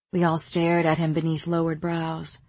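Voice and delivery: ordinary delivery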